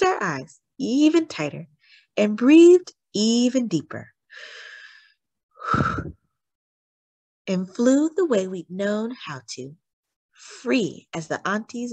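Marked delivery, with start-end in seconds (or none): 5.6-6.1 deep sigh. A narrator's reading. Children breathe deeply.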